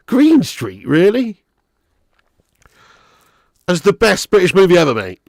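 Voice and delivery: high, loud disbelief; incredulous. Real, not acted. Streamer doubting a viewer's film pick, incredulous. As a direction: question it in high-pitched disbelief.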